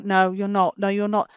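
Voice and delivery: ordinary delivery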